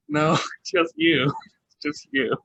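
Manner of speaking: slow, amused, breaking into laughs; teasing and tickled; laughing retort. Real, not acted. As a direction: laugh between the words. Laughing at own retort to quote.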